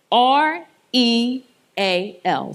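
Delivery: letter by letter, slow; spelled out slowly. Shown as a demonstration. Speaker teaching the acronym, spelling it out slowly. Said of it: "using the acronym".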